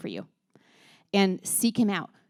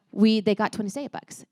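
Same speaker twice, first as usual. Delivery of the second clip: ordinary talk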